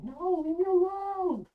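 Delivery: put-on high, pleading child's cry; a small girl begging to be left alone; small frightened girl. Ona crying out to her attacker, high and pleading. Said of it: "the small voice of Ona".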